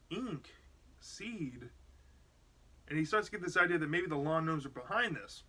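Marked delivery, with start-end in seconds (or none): 0.1-1.7 softer, half to himself; puzzled murmur. Retold or imitated. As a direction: muse over the clues quietly. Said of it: "he's like".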